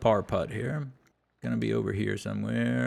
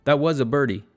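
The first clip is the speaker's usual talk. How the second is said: excited; 5 semitones higher, 7 dB louder than usual. Real, not acted. Celebrating unexpected birdie.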